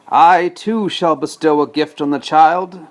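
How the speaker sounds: villain's sneering curse. Acted. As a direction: sneer it down at the cradle. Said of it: "she said with a sneer".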